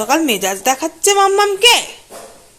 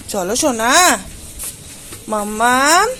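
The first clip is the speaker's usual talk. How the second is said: ordinary talk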